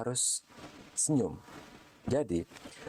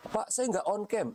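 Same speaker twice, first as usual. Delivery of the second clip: squeaky, much higher pleading excuse; high student voice; 12 semitones higher than usual. Retold or imitated. Lecturer mimics a student's excuse in a high voice. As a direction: pipe it up like a student dodging the camera.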